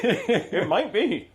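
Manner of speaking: high, slow, laughing repeat; slow laughing drawl. Acted. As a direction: drag it out while laughing.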